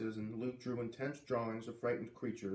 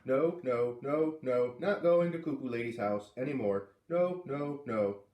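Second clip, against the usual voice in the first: loud, whining no, no; a boy digging in his heels; refusing little boy; 5 dB louder than usual. Acted.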